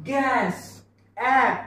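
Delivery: गॅस, then its ॲ alone as in cat; "æ" vowel shown. Shown as a demonstration. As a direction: isolate the short front ॲ of गॅस. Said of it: "याचा उच्चार ॲ असा आला".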